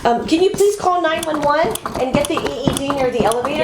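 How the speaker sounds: high, hurried urgent ask; urgent request. Acted. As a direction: press a bystander for help. Acted emergency request.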